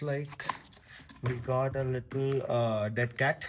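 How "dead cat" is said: normal talking voice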